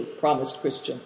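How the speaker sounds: plain reading voice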